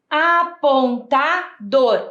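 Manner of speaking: "Apontador" slowly parted as a-pon-ta-dor; syllable by syllable. Shown as a demonstration. Teacher asking which letters make dor, "Apontador" syllable by syllable. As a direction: sound out "Apontador" with a beat on each syllable. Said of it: "tá faltando a sílaba dor".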